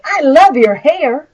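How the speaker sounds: mean it, a genuine compliment; sincere compliment. Shown as a demonstration. Teacher shows a sincere compliment to a young lady. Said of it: "she'll probably think that I like it".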